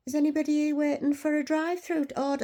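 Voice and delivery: high, projected staff announcement; calling out. Retold or imitated. Staff member to waiting customers, calling out high. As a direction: imitate a worker calling across the counter.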